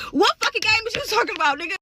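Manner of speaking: high, loud, baffled mock outburst; loud incredulous. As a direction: throw the question back loudly in disbelief. Same reply repeated after laughing.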